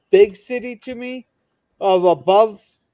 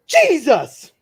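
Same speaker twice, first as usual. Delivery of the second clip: startled yelp; 7 semitones higher, 3 dB louder than usual. Acted. Joke: girl poked, yelps.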